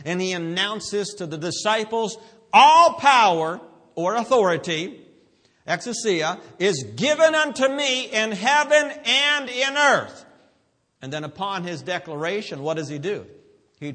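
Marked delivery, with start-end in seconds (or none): 2.5-10.2 loud, high, soaring preacher delivery; forceful preaching. Real, not acted. Preacher marvels to congregation at a healing, full-force preaching. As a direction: build it up and ring it out.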